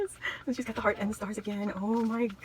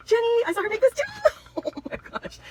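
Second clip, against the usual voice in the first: laughing; 12 semitones higher, 4 dB louder than usual. Real, not acted. Laughing with delight at gift.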